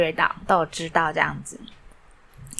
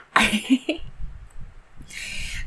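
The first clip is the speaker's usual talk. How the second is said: soft breathy laugh; 6 semitones higher, 5 dB quieter than usual. Real, not acted. Laughs at own photo count.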